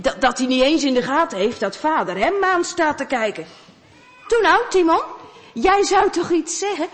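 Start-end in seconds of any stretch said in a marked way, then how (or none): 4.3-6.9 urging father. Acted.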